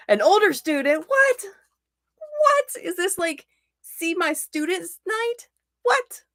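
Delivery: high-pitched, astonished questioning; incredulous surprise. Real, not acted. Teacher finding it's see my students night, high-pitched disbelief. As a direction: squeak out each question in disbelief.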